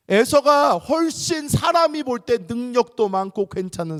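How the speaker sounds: preacher extolling Esau, loud; emphatic shout. Real, not acted. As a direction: proclaim it with force.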